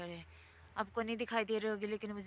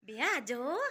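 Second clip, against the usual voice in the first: put-on sing-song baby talk; 4 semitones higher, 6 dB louder than usual. Coaxing a small child to speak.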